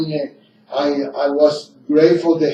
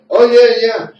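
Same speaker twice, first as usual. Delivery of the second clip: louder, higher quoted yes; quick-to-agree mimicry, pitched up; eager agreement; 7 semitones higher, 6 dB louder than usual. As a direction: imitate someone jumping at the offer. Quoting the man's eager acceptance.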